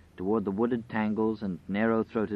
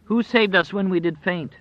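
high, louder litany question; wolf's questioning voice; 9 semitones higher, 5 dB louder than usual. A narrator's reading. Grinning wolf asking itself, high questioning voice. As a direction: call the question out, raised and loud. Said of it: "began to speak in two voices".

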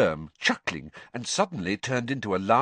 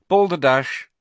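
called out firmly, louder; password called out; 5 semitones higher, 8 dB louder than usual. Acted. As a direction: announce the password clearly. Password to the portrait.

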